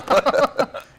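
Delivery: a short airy laugh bursting out; quick unvoiced chuckle; breathy laugh burst. Real, not acted. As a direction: laugh it off, a bit embarrassed. Laughs at own ambition.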